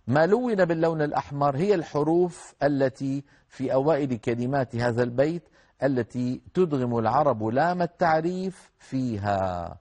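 ordinary talk, nothing marked

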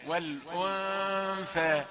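والأنثى with the nun hidden, slowly and nasally held. Shown as a demonstration. Teacher modelling ikhfa in والأنثى. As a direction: let the nun in والأنثى hum without the tongue touching. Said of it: "يعني كيفية النطق".

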